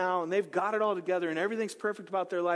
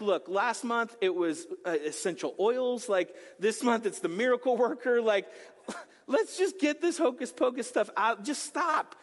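higher, laughing mock-exasperated husband; 5 semitones higher than usual. Husband scoffing at wife chasing the miracle worker, laughing. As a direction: scoff at her latest craze, breaking into a laugh. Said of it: "And he's like".

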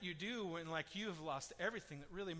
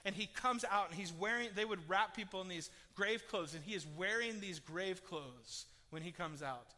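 ordinary delivery

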